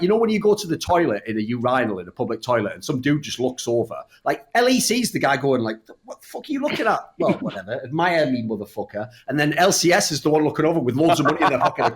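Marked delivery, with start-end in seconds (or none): 6.0-9.1 mock tough guy. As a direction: snap it like a hammed-up bloke. LEC as urinal guy.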